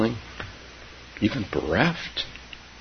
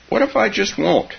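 put-on high, louder childish refusal; child's defiance; 14 semitones higher, 5 dB louder than usual. A narrator's reading. Grandchild refusing Grandma's word on dying, high defiance. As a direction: dig in like a stubborn child, pitched high.

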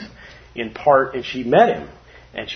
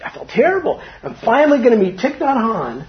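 anxious quote; 7 semitones higher than usual. Retold or imitated. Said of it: "And I was like".

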